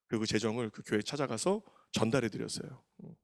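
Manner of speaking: ordinary talk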